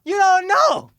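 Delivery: loud high protest through laughs; high excited protest. Retold or imitated. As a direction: shout it back high and laughing. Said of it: "I said".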